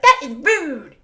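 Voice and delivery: high, mocking scold; exaggerated mock outrage, drawn on the last word; Amber impression. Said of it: "that's how Amber talks".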